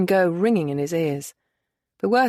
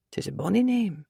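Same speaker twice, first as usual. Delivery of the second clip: distant; 3 dB quieter than usual. Acted. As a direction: say it as if drifting off. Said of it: "he finally said, distantly".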